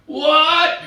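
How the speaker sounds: high, loud shouted question; can't hear you. Retold or imitated. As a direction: yell back over blaring bar music. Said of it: "is always way too loud".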